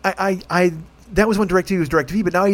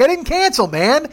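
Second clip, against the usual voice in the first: indignant outburst; 10 semitones higher than usual. Retold or imitated. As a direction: blurt out the denial in outrage. Angry retold phone call.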